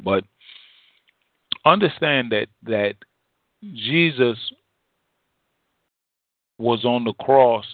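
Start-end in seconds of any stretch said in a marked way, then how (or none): none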